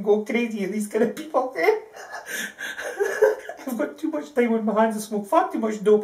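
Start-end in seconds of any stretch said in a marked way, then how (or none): 2.1-3.6 soft, voiceless laughing; breathy laugh. Real, not acted. Laughing at getting so worked up, breathy laugh. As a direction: laugh quietly, mostly on breath.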